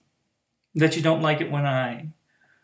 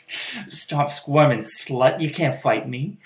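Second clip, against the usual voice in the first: louder, slow and firm; firm command; 7 dB louder, slower than usual. Acted. Mistress pinning her struggling pet, louder firm command. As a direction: hold him still with a firm order.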